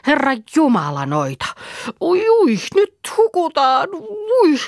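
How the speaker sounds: very high, loud crying wail; drowning panic; panicked cry. Acted. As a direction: shriek as the boat goes over. Boat capsizing, Reeta panics.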